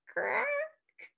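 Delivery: branch crack. A narrator's reading.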